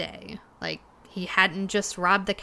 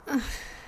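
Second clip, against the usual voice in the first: a soft, tired sigh; weary sigh; 5 semitones higher, 5 dB quieter than usual. Acted. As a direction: breathe out the weight of the day. Overwhelmed before telling the story.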